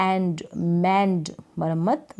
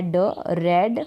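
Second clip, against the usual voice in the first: usual speaking voice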